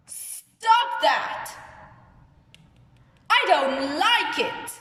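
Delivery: acted angry exclamation. Shown as a demonstration. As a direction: tell someone off, acted for a class. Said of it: "I am angry, isn't it?".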